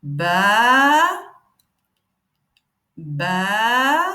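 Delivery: "Ba", "ba" with a pause between them; model syllables. Shown as a demonstration. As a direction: sound out "Ba", then "ba". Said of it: "je vais venir prononcer chaque lettre".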